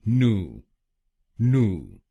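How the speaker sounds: नू said as "noo" with no y; syllable noo. Shown as a demonstration. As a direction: give नू as a plain noo. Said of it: "पहले शब्दांश को यानी एनयू हम बोलेंगे". (American पहला शब्दांश नू)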